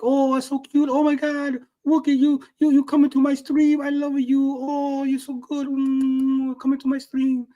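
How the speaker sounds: high-pitched, cooing fangirl-streamer voice; gushing VTuber impression. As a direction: coo sweetly in a mock cutesy high voice. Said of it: "You gotta be like".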